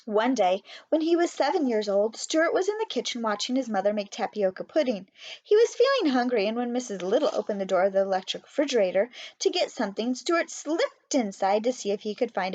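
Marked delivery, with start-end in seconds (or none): none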